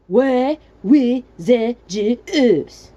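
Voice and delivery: high, loud, very slow grunted words. Acted. Frustrated stranger calling down, loud grunting. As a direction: grunt each word out forcefully. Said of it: "he grunted loudly".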